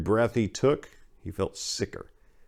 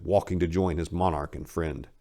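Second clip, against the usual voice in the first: usual speaking voice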